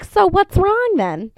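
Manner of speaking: high, slow puzzled asking; puzzled question. Retold or imitated. Owner asking vet, high slow puzzlement. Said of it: "I'm like".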